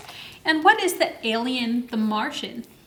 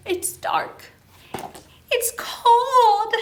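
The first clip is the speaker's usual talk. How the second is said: higher, lightly hammed-up lament; a touch of mock sad astronaut; 5 semitones higher, slower than usual. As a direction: play his sad complaint lightly.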